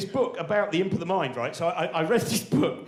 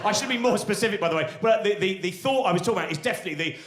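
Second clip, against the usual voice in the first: ordinary delivery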